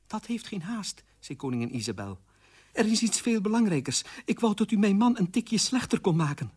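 high, restrained character voice; earnest queen. Acted. Queen asks aunt to change husband, high and earnest.

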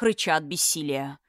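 usual speaking voice

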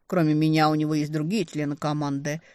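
usual speaking voice

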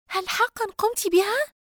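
high, slow, stunned question; amazed and shocked. A narrator's reading. Dina hears the experiment worked, stunned. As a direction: disbelief mixed with wonder. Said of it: "بخليط من العجب والصدمة".

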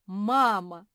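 loud call to a parent; excited full-voice call; shouting. A narrator's reading. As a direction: cry out on spotting your mother. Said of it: "закричал Семён".

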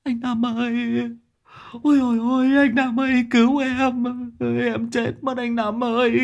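hammed-up frightened crying falsetto; sobbing plea. A narrator's reading. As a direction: beg tearfully for your life. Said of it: "khóc lóc ôm chân lão Năm van xin".

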